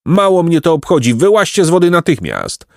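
high-pitched, impatient order; indignant scolding. A narrator's reading. Beaver orders the boys out of the water, angry scolding.

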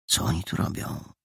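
hushed, puzzled inner thought; thinking to himself. A narrator's reading. Hidden boy watching two strangers, quietly puzzled. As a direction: wonder it softly, almost without voice. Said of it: "myślał Mandżaro".

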